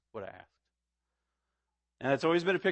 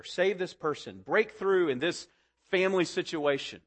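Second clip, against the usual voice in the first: fervent prayer; 4 semitones higher, 4 dB louder than usual. Shown as a demonstration. Preacher shows the congregation how to pray, fervent and building. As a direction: begin hushed, then press each petition harder.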